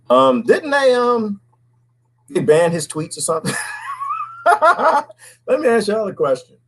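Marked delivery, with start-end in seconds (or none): 3.6-4.4 soft, very high giggle; squeaky, softened laugh. Real, not acted. Host amused at banned tweets, laughing.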